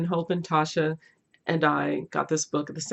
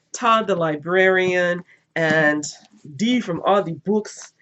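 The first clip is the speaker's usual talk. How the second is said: shout-out; 6 dB louder, slower than usual. Real, not acted. Shout-out to fellow booktubers.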